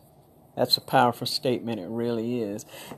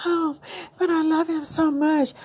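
put-on very high, swooning young woman's voice; a sister sighing over her bad boyfriend; lovestruck girlfriend; 13 semitones higher than usual. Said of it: "she's like".

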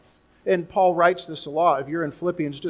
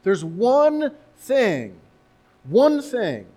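"one" raised high and louder, slow and deliberate; slow down and hammer "one"; preacher stressing "one thing", high and firm; 5 semitones higher, 4 dB louder, much slower than usual. Shown as a demonstration. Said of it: "the point of emphasis".

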